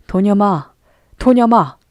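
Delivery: loud, rising angry shout of a name; angry call. A narrator's reading. Head monk calling the boy from the temple, angry shout. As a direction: call him in anger from inside. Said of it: "부르는 노성".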